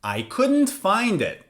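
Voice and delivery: "I couldn't find it" slowly, starting high and falling to "it"; slow, final drop through "find it"; falling intonation. Shown as a demonstration. Teacher's example of something finished, "find it" falling. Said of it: "when something is finished and over".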